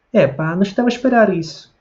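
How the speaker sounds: high-pitched Portugal Portuguese intonation; European Portuguese accent. Shown as a demonstration. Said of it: "tento imitar a pronúncia dos portugueses". (sotaque de Portugal, em tom agudo)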